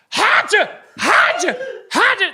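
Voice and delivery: high, loud mock sneezing fits; imitated sneezes. Mocking the loud Dallas family on the boat, hammed-up sneezes. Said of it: "They were just sneezing so much".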